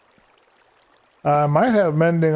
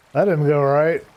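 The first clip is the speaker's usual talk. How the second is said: ordinary delivery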